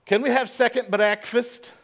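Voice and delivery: high, loud British accent impression; put-on British accent. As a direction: ask eagerly in a mock English accent. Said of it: "if you talk in an accent".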